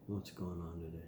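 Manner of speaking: quiet murmured echo. Shown as a demonstration. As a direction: drop it right down. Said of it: "repeated softer".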